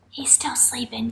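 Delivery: very soft, hushed aside; whisper. Pretend. Teacher tells class the puppet sleeps, whispering. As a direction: whisper so as not to wake him.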